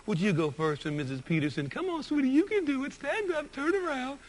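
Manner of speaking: high, sugary coaxing teacher voice; teacher urging a little boy to stand up, sweet and high. Retold or imitated. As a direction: coax a shy child like a kind teacher. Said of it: "with a big old smile, she bent down and she said".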